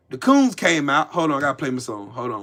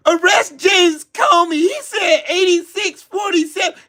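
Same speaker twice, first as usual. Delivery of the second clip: mock outraged yell; 9 semitones higher than usual. Mocking Trump's demand.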